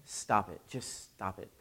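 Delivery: soft, low, matter-of-fact repeat; quiet flat stop it. Retold or imitated. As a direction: say it again, low and deadpan. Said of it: "he's like".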